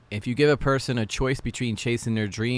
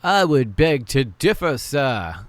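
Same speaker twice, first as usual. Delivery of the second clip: loud, slow, pompous rebuttal; mock-formal objection; 4 semitones higher, 6 dB louder, slower than usual. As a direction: object grandly, drawing out each word.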